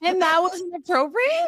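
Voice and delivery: high incredulous question; surprised, checking the story. Real, not acted. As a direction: friend asks in disbelief. Stunned question to friend.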